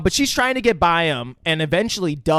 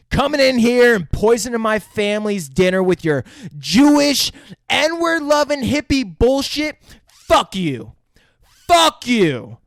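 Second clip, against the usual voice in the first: louder, high shouted insults; hammed-up furious rant; mock angry yelling; 6 semitones higher, 4 dB louder than usual. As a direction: yell it over and over at him. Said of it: "just keep yelling".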